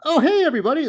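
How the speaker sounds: high, bubbly, over-eager greeting; a chirpy imitation of Gerard; cheery guy imitation. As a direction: mimic a grinning people-pleaser. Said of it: "he's that cheery, cheery guy".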